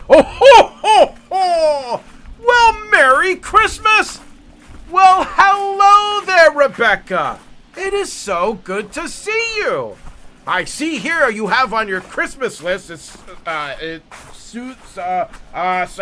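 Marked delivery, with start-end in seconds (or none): 0.1-6.5 booming high ho-ho-ho Santa, slow and hearty; jolly Santa voice. Shown as a demonstration. Recording a video for a child, jolly Santa voice. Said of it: "I start as Santa". 6.5-12.9 lightly held Santa voice, a little higher; jolly Santa voice. Shown as a demonstration. Reading a child's Christmas list, lightly jolly Santa. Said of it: "I start as Santa".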